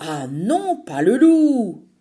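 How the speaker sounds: loud, slow protest in a put-on girl voice; grumbling Emma. Emma grumbles as the story turns to the wolf, loud slow protest. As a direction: drag out the groan at the wolf. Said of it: "grommelle Emma".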